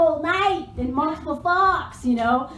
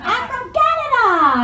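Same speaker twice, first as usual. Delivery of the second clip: high, drawn-out mock-eager girl; perky introduction; 9 semitones higher, 5 dB louder, slower than usual. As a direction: imitate an eager introduction, pitched way up. Same girl's introduction continues.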